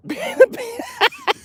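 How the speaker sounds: a sudden high-pitched laugh; squealing laugh; high burst of laughter. Real, not acted. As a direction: crack up high at the upset. Laughs at chat upset.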